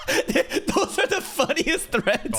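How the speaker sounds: laughing. Real, not acted. Laughing while talking.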